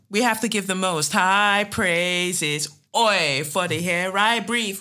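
slow, loud gospel preacher voice; mock preacher praise. Host gives thanks, a hammed-up preacher. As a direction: preach it like a church sermon.

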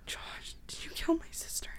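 breathless, whispered dread; horrified whisper. Acted. Woman asking if he killed her sister, horrified whisper. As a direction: ask it barely daring to.

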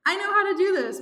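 high, loud burst of confidence; excited acted announcement. A narrator's reading. Team offering a plan, excited. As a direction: blurt it out as the eager rescuer.

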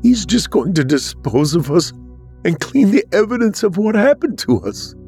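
high-pitched tearful protest. A narrator's reading. Olivia to Samson about being disposed of, through fresh tears. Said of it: "Olivia said through fresh tears".

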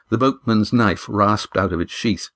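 plain reading voice

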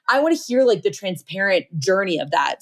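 ordinary talk

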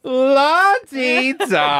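high, loud, very drawn-out teasing singsong; mock fancy. Co-host teasing her about the hotel concierge, mock fancy.

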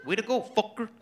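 put-on light Mexican accent, proud dad. Mexican father praises his son's report card, in a light Mexican accent. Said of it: "I'm like".

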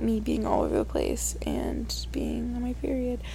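breathy whisper, sharing a secret; whispered aside. Real, not acted. Telling listeners about her period, whispered. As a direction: murmur it like a confession. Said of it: "whispered aside".

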